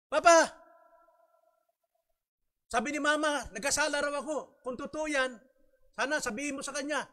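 put-on high, loud pleading child voice; a child calling down from upstairs, begging forgiveness. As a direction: beg like a child sent to his room.

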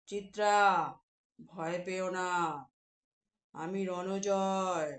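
low, slow, soothing giant voice; deep gentle giant. Acted. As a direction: reassure her slowly from deep down.

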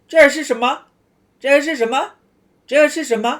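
这是什么 rattled off quickly and high; fast native speed. Shown as a demonstration. Teacher showing students how fast Chinese people talk. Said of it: "เขาพูดเร็วประมาณนี้แหละ".

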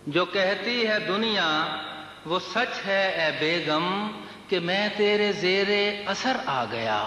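measured mushaira declamation, words drawn out; lilting Urdu verse delivery; poetry recitation. Acted. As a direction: recite the couplet to a listening crowd.

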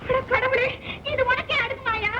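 suara tinggi, merintih menyesali nasib; wailing lament. Acted. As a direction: meratap kepada Tuhan, nada tinggi. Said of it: "ஏன் புலம்புற?".

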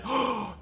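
high, quick intake of breath; excited gasp. Real, not acted. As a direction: gasp as it lands. Sees the third one placed.

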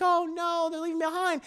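high-pitched mock dismay; dismayed sympathy. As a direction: play a woman fretting for a stranded bird. Arena worries for the left-behind bird.

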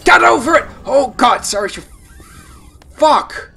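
high, loud bursts of exasperation; frantic angry yelling. Real, not acted. Player cursing Seraph to get over it, frantic yelling. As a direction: yell it in real frustration. Yelling at character stuck on obstacle.

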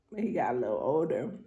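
ordinary talk, nothing marked

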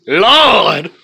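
loud, high cry of surprise; loud exclamation. Real, not acted. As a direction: belt out a shocked Lord.

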